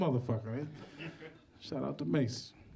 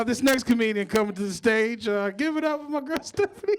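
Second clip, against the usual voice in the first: loud MC introduction rising higher; announcing the next act; 5 semitones higher, 6 dB louder than usual. Real, not acted. MC bringing a comedian to the stage, loud and building. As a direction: build to a big shouted name.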